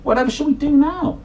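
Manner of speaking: very high, worried cat voice; fretful Flabby Cat. Acted. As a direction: ask it in a high, anxious cat voice. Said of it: "asked Flabby Cat".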